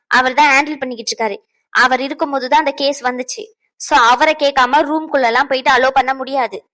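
heated, insistent scolding; angry telling-off. Acted. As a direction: lay down the rules crossly. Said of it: "கோவப்பட்டு கத்திக்கிட்டு கிடக்கா".

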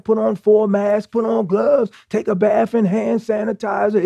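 high, urgent, panicky warnings; alarmist news narrative. Acted. News narrative warning the public of crisis, mock alarm. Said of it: "they had the narrative on the news".

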